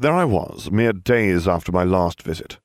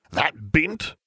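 high, incredulous bark without much volume; barked question; 9 semitones higher than usual. A narrator's reading. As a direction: bark it as a pitched-up, scornful question. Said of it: "Sirius barked".

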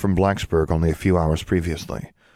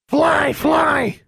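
shrill, very high raven squawk, loud; 5 dB louder than usual. Acted. Pet raven squawking eagerly to go outside. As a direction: screech it like an excited raven.

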